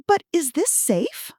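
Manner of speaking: put-on timid old lady. Fake granny doubting the caller, slow and high. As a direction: ask it fearfully, drawing it out. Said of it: "I maintained my confused elderly woman role".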